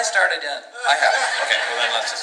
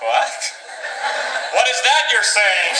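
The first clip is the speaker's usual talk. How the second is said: baffled; 8 semitones higher, slower than usual. Acted. Confused tourist exclaiming.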